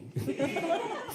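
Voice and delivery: high giggling burst; laughing. Real, not acted. Laughs at absurd apology.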